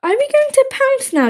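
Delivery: put-on high little child voice; baby komodo. A narrator's reading. Baby asking Dad about pouncing, childlike. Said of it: "asked Baby".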